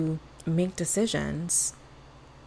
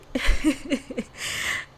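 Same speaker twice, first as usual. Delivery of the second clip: breathy laugh; 8 semitones higher than usual. Real, not acted. Laughing after the reply.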